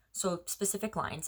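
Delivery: ordinary delivery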